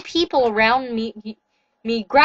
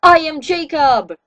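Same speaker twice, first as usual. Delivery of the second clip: slow, loud proclamation, higher at the end; yelled; 5 semitones higher, 8 dB louder, slower than usual. A narrator's reading. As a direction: announce the title with a roar. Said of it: "he yelled".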